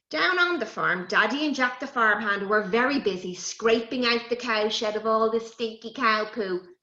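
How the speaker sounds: ordinary delivery, nothing marked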